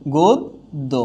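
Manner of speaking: গদ্য said as "গোদ্দো", with a doubled দ; model pronunciation. Shown as a demonstration. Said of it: "গদ্য হয়ে যাচ্ছে কি?".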